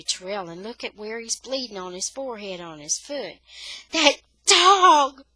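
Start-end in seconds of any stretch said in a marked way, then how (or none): 3.9-5.2 shrill, loud scream again. Acted. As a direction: scream it in panic. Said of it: "she screams again".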